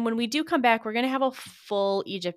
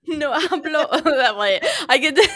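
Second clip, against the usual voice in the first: laughing out the words, louder; 7 semitones higher, 9 dB louder than usual. Real, not acted. Laughing at her friend's Spanish joke, louder. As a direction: crack up mid-phrase.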